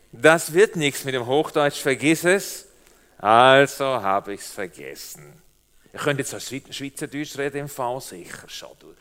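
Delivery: ordinary talk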